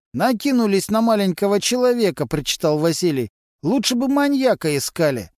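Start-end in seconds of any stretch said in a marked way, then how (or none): 0.1-2.3 Vasily's voice. A narrator's reading. Vasily's gruff high voice. 3.6-5.3 Vasily's voice. A narrator's reading. Vasily grumbles at police.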